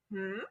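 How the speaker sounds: soft inquiring "hmm?"; questioning hum. Retold or imitated. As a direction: hum a quiet little question. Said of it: "like, a questioning sound".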